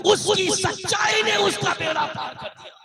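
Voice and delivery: high, loud sermon climax; full-force preaching. Acted. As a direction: cry out the pardon to the whole congregation.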